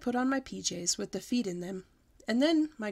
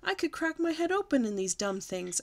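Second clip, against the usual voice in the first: put-on higher, loudish childish outburst; 7 semitones higher than usual. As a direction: complain out loud after the fall. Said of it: "I said very loud".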